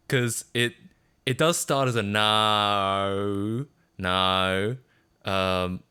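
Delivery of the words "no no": "no" stretched long into an Aussie O; Australian O. Shown as a demonstration. Streamer showing how the Aussie O starts, long drawn-out vowel. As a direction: draw out "no" with a gliding Australian vowel. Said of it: "The Australian O, um, is a tough one".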